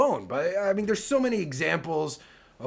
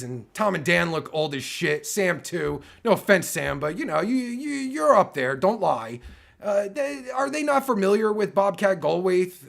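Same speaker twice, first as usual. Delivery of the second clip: ordinary delivery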